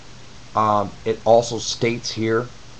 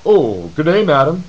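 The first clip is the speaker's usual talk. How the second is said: sarcastic, prim and proper, higher and louder; mock polite greeting; 5 semitones higher, 7 dB louder than usual. Mocking soldiers who greet a girl with fake courtesy, prim and sarcastic. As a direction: ham up a dainty gentleman's greeting.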